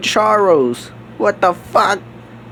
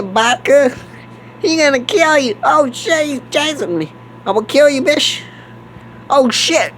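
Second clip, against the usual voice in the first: ordinary talk, nothing marked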